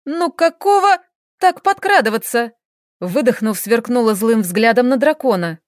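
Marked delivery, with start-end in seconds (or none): 0.0-2.5 indignant. Acted. Startled, scolding the dragon.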